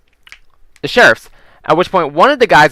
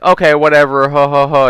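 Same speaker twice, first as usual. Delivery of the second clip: mocking dismissive. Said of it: "pretty much, Fuck you".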